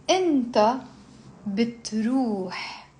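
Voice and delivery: pronoun, pause, then stretched verb; slow conjugation drill. Shown as a demonstration. Teacher leading students through a verb, slowly. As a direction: draw out each word for repeating. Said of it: "you slowly build up".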